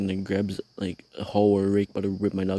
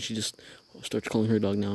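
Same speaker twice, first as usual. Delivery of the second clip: usual speaking voice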